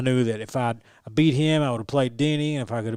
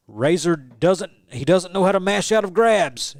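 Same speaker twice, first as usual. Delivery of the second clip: higher, louder trash-talk commentator voice; mocking commentator taunt; 6 semitones higher, 6 dB louder than usual. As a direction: jeer like a heckling commentator. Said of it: "crap talking me the entire time".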